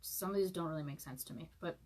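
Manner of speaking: ordinary talk, nothing marked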